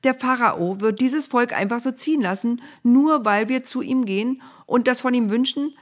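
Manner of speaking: high, loud disbelieving question; incredulous objection. A narrator's reading. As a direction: challenge it as wildly naive. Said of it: "wandte Mose ein".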